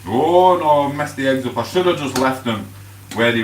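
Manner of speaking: sudden loud, high cry of dismay over the eggs; a real groan of oh no as the eggs fail. Real, not acted. Ruins the eggs while cooking.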